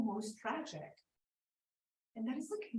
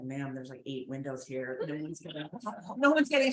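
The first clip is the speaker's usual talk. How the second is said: much lower, deadpan police officer voice; bored cop; 9 semitones lower, 3 dB louder than usual. Retold or imitated. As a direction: drop low like an unimpressed cop.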